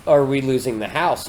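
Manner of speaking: ordinary talk, nothing marked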